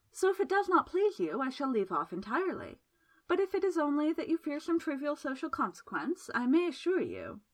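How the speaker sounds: lightly voiced temptress, coaxing; a hint of a teasing seductress; seductive woman. A narrator's reading. Miss Absalom voice.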